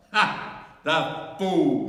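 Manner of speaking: high, loud witch's cry; shrill triumphant witch cackle. A narrator's reading. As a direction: spit it out like a gloating witch. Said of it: "she cried".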